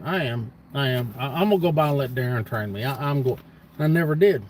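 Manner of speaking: ordinary talk, nothing marked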